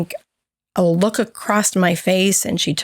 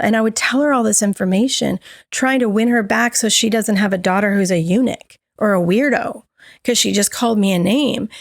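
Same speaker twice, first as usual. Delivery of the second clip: ordinary talk, nothing marked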